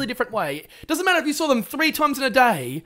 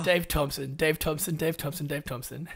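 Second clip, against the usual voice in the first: low, fading dismayed groan; shuddering American; 6 semitones lower, 6 dB quieter than usual. Acted. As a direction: repeat it lower and softer each time. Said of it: "Americans are just shuddering right now going".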